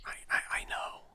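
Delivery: breathy hush; whispering. Real, not acted. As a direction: whisper it back quietly. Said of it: "Why are we whispering?".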